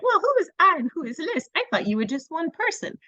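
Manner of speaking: put-on high, puzzled play-along voice to a child; mock puzzled. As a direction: act baffled to make the point. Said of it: "And you're like".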